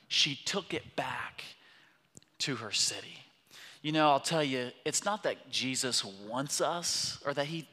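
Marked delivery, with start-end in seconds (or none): 0.1-3.2 hushed. Real, not acted. Preacher drops to a hush.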